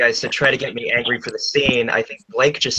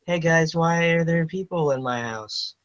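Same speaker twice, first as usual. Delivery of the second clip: casual translation voice; 6 semitones higher, 4 dB quieter than usual. Retold or imitated. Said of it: "roughly translates to".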